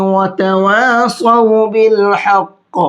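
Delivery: slow, chanted tajweed recitation; Quranic recitation. Shown as a demonstration. Teacher chanting the verse for students, slow and melodic. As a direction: recite it slowly in melodic Arabic.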